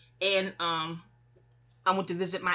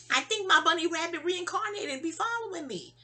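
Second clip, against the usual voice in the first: usual speaking voice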